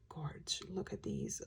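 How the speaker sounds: hushed whisper of awe. Real, not acted. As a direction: whisper it like a secret. Gushing over eyeshadow swatches.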